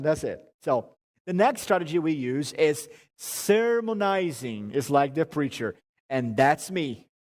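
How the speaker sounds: ordinary talk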